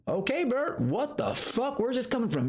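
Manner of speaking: high incredulous okay Bert what the fuck; mock shock at a friend's rant; retold disbelief.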